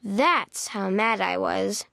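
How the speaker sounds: ordinary delivery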